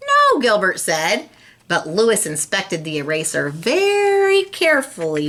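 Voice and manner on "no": innocent no. Acted. Gilbert's innocent denial.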